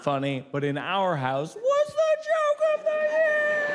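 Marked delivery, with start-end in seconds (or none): 1.6-3.8 very high, mock-thrilled squeal; hammed-up glee. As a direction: squeal it in over-the-top delight, slowing down. Mock glee at silly family pun.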